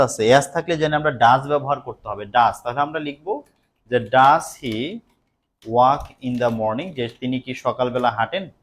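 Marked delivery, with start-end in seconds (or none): 4.0-6.9 slow, word by word dictation. Shown as a demonstration. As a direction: spell it out slowly for students. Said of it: "teacher dictates slowly". (ধীরে ধীরে বলা)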